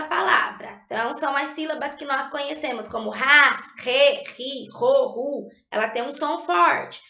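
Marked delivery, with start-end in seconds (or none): 3.1-5.5 "ra, re, ri, ro, ru" with a strong throaty R, not a tap; strong R syllables. Shown as a demonstration. Teacher demonstrating initial R to pupils, strong guttural R. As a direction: say "ra, re, ri, ro, ru" firmly with the guttural R. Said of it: "o som do R é mais forte".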